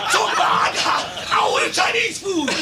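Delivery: high, loud indignation through laughter; laughing mock outrage. Imitating Mister Watts venting to Spank, laughing mock outrage. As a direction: imitate an outraged man while cracking up.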